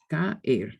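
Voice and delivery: Caer said slowly as two syllables, ca-er; separated vowels. Shown as a demonstration. Teacher repeating Caer for students to copy. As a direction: keep the a and e of Caer apart. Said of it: "A-E".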